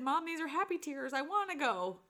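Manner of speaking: a hint of a teary girl, lightly voiced; daughter's happy tears. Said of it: "she said".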